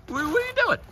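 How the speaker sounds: high, fast amused disbelief; surprised. Real, not acted. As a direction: catch him copying you. Wrestler mimics the commentator.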